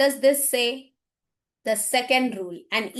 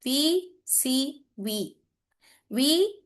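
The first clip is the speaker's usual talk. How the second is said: slow letter by letter; much slower than usual. Shown as a demonstration. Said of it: "this pattern is V C V pattern".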